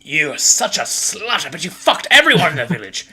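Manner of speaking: high, hammed-up jealous rant; outraged husband in a put-on voice; quarrelling husband. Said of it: "As he goes".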